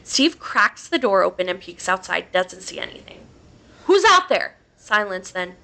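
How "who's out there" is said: loud, high challenge; calling out. A narrator's reading. Steve calls out the cabin door to whoever is there, shouting. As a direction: shout it out into the dark.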